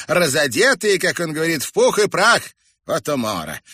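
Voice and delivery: high, loud derisive glee; mocking high-pitched exclamation; shrill amusement. A narrator's reading. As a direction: crow over the absurdity. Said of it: "И снова разразилась визгливым смехом".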